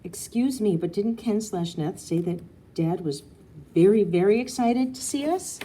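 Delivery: put-on loud, pointed child's protest; indignant child. A narrator's reading. Daughter challenging the grown-ups, loud and indignant. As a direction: call them out on the broken promise.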